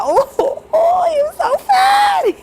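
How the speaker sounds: put-on high, laughing swoon over a cute boy; swooning squeal. Teen girl swooning over her crush, in a high laughing squeal. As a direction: gush like a lovestruck teen, laughing.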